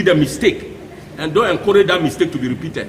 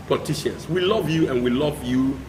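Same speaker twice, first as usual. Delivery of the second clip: ordinary talk, nothing marked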